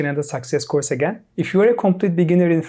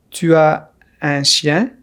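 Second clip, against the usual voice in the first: Tu as un chien slowly, in parts; careful slow question for learners; slow model; slower than usual. Shown as a demonstration. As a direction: pause after Tu as, then un chien. Slow model, then natural repeat.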